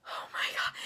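a soft, stunned whisper. Retold or imitated. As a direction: breathe it out in awe.

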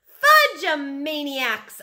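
high, loud, drawn-out word; joking call. A narrator's reading. Peter adds his joke to Libby's shout, playful call. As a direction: call out a pun, stretching it.

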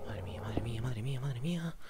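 very soft, breath-only repetition; hushed, shaken whispering; stunned whisper. Real, not acted. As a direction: whisper it over and over in shock. Stunned after the scare.

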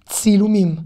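"Tsilumim" with a clear opening ts; "Tsilumim" as an isolated model word; pronunciation demo. Shown as a demonstration. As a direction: repeat "Tsilumim" for learners, t straight into s. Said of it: "comment ça se prononce".

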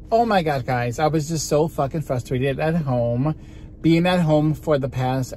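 ordinary talk, nothing marked

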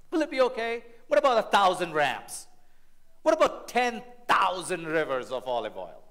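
drip sarcasm on every question; sarcastic lawyer. Acted. Lawyer taunting the Lord, mock-earnest. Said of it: "a little bit of sarcasm here".

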